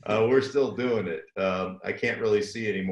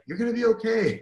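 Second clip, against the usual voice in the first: much higher mock soothing voice; strangers soothing; 13 semitones higher, 3 dB louder, faster than usual. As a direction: imitate strangers comforting a crying dad, high. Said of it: "people we don't even know are like".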